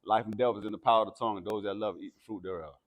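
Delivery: ordinary delivery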